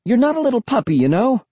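high, chiding tone; scolding the dog. Acted. Narrator to overgrown dog, high-pitched scolding.

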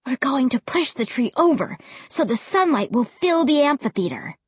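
usual speaking voice

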